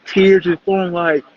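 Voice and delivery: ordinary talk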